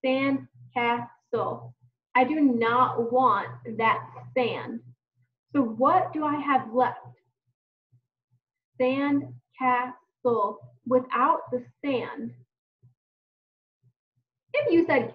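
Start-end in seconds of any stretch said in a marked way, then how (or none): 0.0-1.7 slow "Sandcastle" in three beats, sand, cas, tle; "Sandcastle" tapped out syllable by syllable; split into syllables. Shown as a demonstration. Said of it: "thinking about the syllables". 8.8-10.6 slow "Sandcastle" in three beats, sand, cas, tle; "Sandcastle" tapped out syllable by syllable; split into syllables. Shown as a demonstration. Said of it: "thinking about the syllables".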